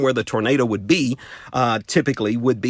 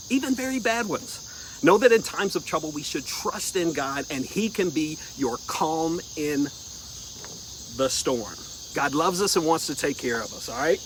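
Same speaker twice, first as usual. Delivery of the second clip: soft, gentle storybook voice; reading to children; 4 semitones higher, 9 dB quieter than usual. Acted. Host reading a Bible story to children, soft and gentle. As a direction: tell it softly to little ones.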